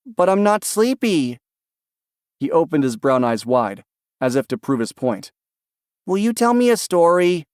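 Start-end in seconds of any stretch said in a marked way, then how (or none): 0.1-1.4 put-on high, slow child's whine; protesting little boy. A narrator's reading. 6.1-7.5 put-on high, eager child's voice; hopeful little boy. A narrator's reading. Child asks for story.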